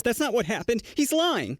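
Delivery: high-pitched mock protest; wild Karen. Angry mother accusing a man to staff, mock Karen protest. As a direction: imitate an indignant woman, voice climbing. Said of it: "The wild Karen says".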